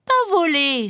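high-pitched jeering bird voice; mocking bird. A narrator's reading. Little bird making fun of the duck, mocking. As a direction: taunt in a high, chirpy bird voice. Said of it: "se moqua l'oiseau".

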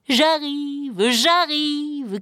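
high, drawn-out eager call. A narrator's reading. Pierre running toward the gate after the bird, calling out. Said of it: "cria Pierre en courant".

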